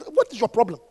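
ordinary talk, nothing marked

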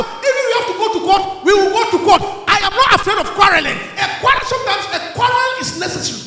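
loud, combative sermon delivery; full-force preaching. Acted. Preacher urging the congregation to quarrel, loud and combative. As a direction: hammer it out, unafraid of a fight.

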